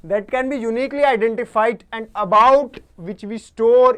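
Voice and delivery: loud, slow, with "about" and "store" stretched; slow classroom dictation, punching "about" and "store" loudly; emphatic definition reading. Real, not acted. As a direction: hammer out the definition, drawing out "store". Reads out key definition emphatically.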